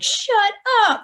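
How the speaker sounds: very high, loud squeal of disbelief; amazed shut up. Retold or imitated. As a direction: shriek it like unbelievable news. Bandmates react in disbelief.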